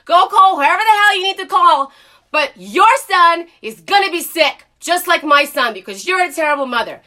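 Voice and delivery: high, loud defiant shout; retold yell at the gate agent, fading at the end; screaming back. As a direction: shout her down in fury. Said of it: "I'm like".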